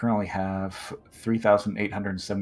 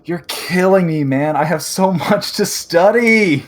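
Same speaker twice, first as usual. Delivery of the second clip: loud, high mock despair; exasperated laughing complaint; 10 semitones higher, 12 dB louder than usual. Real, not acted. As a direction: groan it out through laughter. Player groans at homework.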